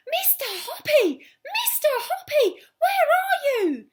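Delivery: high, loud, slow calls up the stairs; excited shout. Acted. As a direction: yell for him in wild excitement. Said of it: "she was shouting".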